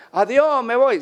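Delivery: put-on high, loud kid's goodbye; gleeful boy calling out. Boy galloping off on the horse, gleeful. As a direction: shout it as you ride away.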